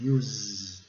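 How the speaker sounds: "Use" ending in a long buzzed Z; low "Use", said yooz with the Z held. Shown as a demonstration. Teacher shows the final sound, careful pronunciation. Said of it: "No S com som de Z".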